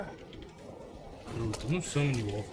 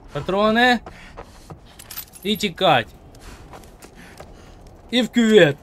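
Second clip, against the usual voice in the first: loud, higher panicked calls; excited shouting; 9 semitones higher, 10 dB louder than usual. Real, not acted. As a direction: shout while running away. Хапає патрони і тікає. (схвильовані вигуки)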